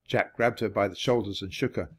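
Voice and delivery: ordinary delivery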